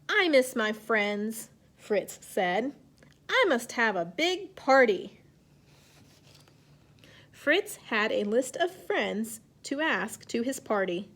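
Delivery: slow, clear picture-book reading; reading to children. A narrator's reading. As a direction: read it gently to young kids. Teacher reads story aloud.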